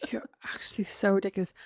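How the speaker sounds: whispered, affectionate jab; whispered tease. Real, not acted. Teasing her friend in a whisper, amused. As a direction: breathe it out, teasing.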